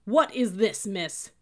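loud, high outburst, dropping on the last word; angry cursing. A narrator's reading. Sergeant jabs at the book, cursing angrily. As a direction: demand it in outrage. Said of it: "he cursed".

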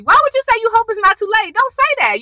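rapid-fire disbelieving scold at a character; exasperated scolding. Real, not acted. Scolding Jake's phone call.

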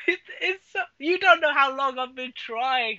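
high-pitched, laughing protest. Real, not acted. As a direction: talk through laughter, voice shooting up. Laughing after being teased as excited.

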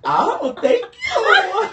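squealing with delighted laughter; high, loud, laughing thanks. Real, not acted.

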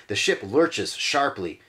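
ordinary delivery, nothing marked

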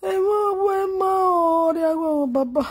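long, sustained crying vowels; real grieving wail. Real, not acted. Mourner crying out to baba in grief, wailing.

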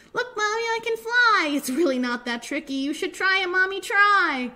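high, loud, thrilled little penguin; Pip-Pip's excited cry. Acted. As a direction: urge mommy on like an overjoyed child. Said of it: "cried little Pip-Pip".

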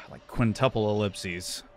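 ordinary talk, nothing marked

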